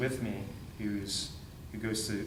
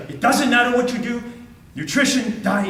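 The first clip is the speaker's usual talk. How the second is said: imitated doctor yelling, much higher and louder; 12 semitones higher, 12 dB louder than usual. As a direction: shout it at him like a furious doctor. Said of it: "He starts yelling".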